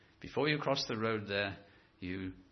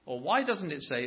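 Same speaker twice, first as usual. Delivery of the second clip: ordinary talk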